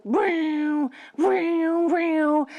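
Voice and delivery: mock saber vroom; lightsaber hum. Duel with Vader, mock lightsaber vroom.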